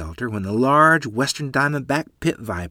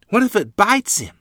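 put-on worried child; 11 semitones higher, 5 dB louder than usual. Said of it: "Lottie worried out loud".

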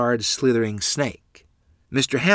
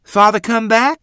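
put-on innocent child question; 12 semitones higher, 9 dB louder than usual.

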